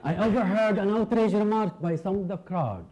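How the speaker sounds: usual speaking voice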